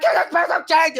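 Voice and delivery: very high, garbled beggar's squawk; hammed-up panhandler. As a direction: squeeze the plea into a high mush. Acting out begging again, exaggerated.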